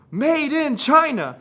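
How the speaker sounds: put-on high, slow, proud kid; the little cousin reading the label. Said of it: "He goes".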